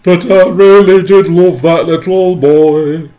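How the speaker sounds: high, loud wailing lament; sobbing earthworm. Acted. Earthworm sobbing over the lost boy, wailing. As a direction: wail it through tears. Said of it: "the earthworm sobbed".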